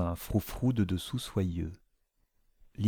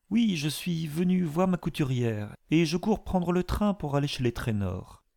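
higher, rushed excuses; hurried explanation; 8 semitones higher than usual. A narrator's reading. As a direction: blurt the excuse out quickly. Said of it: "explications précipitées".

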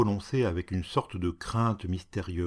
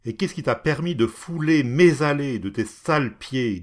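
higher and louder, contemptuous; angry scolding; 4 semitones higher, 5 dB louder than usual. Acted. Cat berating the woman over his paths. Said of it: "dit, continua-t-il".

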